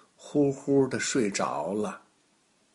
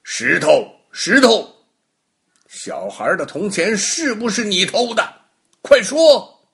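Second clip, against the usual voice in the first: higher and louder, ending in a shouted order; pressing harder; 8 semitones higher, 7 dB louder than usual. Acted. Baogong asks the stone again, higher and louder demand. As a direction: magistrate losing patience, barking quick now. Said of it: "包公又问了".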